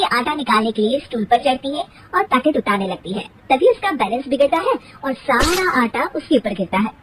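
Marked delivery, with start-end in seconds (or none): none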